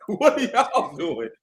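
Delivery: said through laughter; laughing. Real, not acted. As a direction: laugh while asking it. Laughs while repeating.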